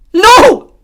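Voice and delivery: a high, loud scream. A narrator's reading. Jordan and Emma screaming at the dashboard, loud. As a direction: scream it out in horror. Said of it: "she cried".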